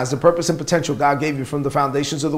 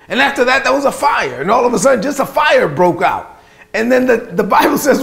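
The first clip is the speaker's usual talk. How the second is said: high and louder, building the fire scene; rising excitement; 8 semitones higher, 4 dB louder than usual. Acted. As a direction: lift the voice high with the drama.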